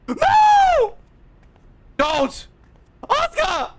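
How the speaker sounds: high, loud cry of protest; real distressed outburst at the game; anguished shout. Real, not acted. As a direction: yell at the screen to stop it. Watching favorite wrestler get hurt.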